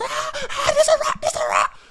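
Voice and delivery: very high, loud squealed alarm; hammed-up falsetto panic; panicked girl's scream. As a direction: imitate a girl shrieking in terror. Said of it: "the girl jumps on top of the guy's back and goes".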